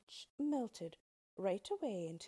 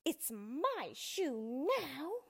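slow, high, gloating witch; drawn-out witchy taunt; hissing witch; 7 semitones higher, much slower than usual. A narrator's reading. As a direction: draw it out possessively as a witch. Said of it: "hissed the witch".

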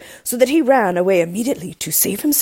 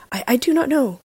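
high, faltering plea; mild fright, pitched up, stammering on the first word; poor man's nervous denial; frightened cry; 7 semitones higher than usual. A narrator's reading. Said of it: "cried the poor man, paler than death".

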